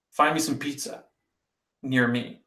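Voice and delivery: ordinary talk, nothing marked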